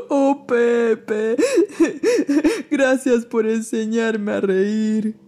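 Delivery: slow, laughing grateful bear; gentle bear person. Acted. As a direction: thank him while laughing. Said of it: "dijo el oso a las carcajadas".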